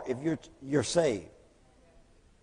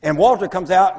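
usual speaking voice

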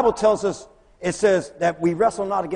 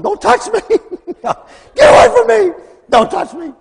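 terrified scream; 12 dB louder than usual. Retold or imitated. Lady screaming at the visitors.

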